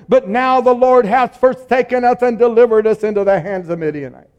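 loud, high-pitched lament to the Lord; complaining. Acted. Gideon complains loudly to the Lord about abandonment. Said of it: "Here he's complaining".

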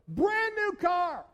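slow, high, loud wail over the car; over his wrecked new car, slow loud dismay; exasperated. Retold or imitated. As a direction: stretch it out in disbelief.

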